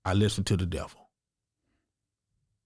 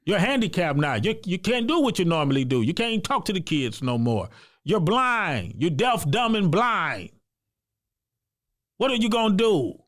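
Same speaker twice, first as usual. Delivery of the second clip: retold devil taunting him, rising higher as it goes; the devil on his shoulder mocking him, building; devil's voice; 7 semitones higher than usual. Man retelling how the devil on his shoulder mocked him, taunting and building higher. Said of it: "Then I got the devil on the other side".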